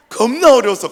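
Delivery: shouted, stretched 겁나 어려웠어 with 겁나 hit hard; loud emphatic. Real, not acted. Preacher shouting to the congregation how hard it was. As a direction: belt out 겁나 as the point of the story. Preacher stresses hardship loudly.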